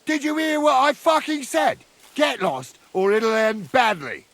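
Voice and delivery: high, angry shout; furious threat. Acted. Ordering enemies to get lost, furious shouted threat. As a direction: yell it as a last warning.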